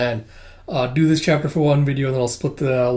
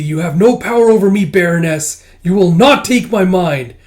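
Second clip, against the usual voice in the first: raised, loud acted defiance; defiant cry; 5 semitones higher, 8 dB louder than usual. Tuya defying the Baroness, loud defiance. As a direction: stand up to the possessor loudly.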